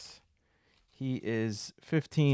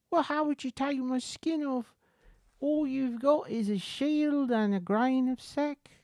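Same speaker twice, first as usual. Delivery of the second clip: high-pitched British protest; British accent; 6 dB louder than usual. Acted. Beggar doubting they can skin him, British accent. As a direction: argue back in a high British character voice. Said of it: "is British".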